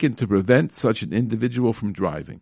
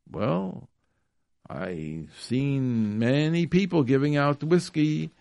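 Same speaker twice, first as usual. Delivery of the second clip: ordinary talk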